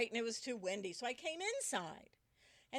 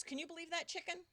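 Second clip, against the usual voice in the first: ordinary delivery, nothing marked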